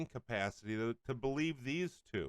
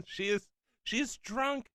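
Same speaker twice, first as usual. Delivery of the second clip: incredulous; 10 semitones higher than usual. Real, not acted. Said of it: "host mocks caller".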